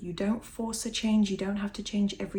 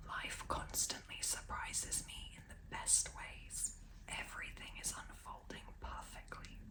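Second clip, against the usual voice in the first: whispered affirmations; 4 semitones higher, 15 dB quieter than usual. Real, not acted. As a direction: whisper each affirmation gently. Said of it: "I'm going to try whispering them".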